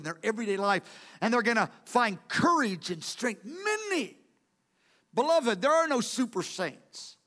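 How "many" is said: Many. leapt up high and held, emphatic; exclaimed word. Real, not acted. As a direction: throw Many. out as the sermon's climax. Preacher exclaims key word.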